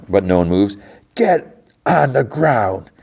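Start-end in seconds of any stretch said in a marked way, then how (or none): 1.2-2.9 higher, drawn-out menacing order; stern command. Retold or imitated. Jod orders the kids when nobody moves, stern. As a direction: imitate a villain ordering people down.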